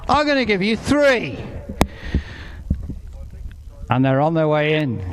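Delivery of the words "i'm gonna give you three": high, falling market trader payoff; sing-song market hollering. Pretend. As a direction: land the big offer like a barrow boy. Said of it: "I'm gonna sound like a market trader now".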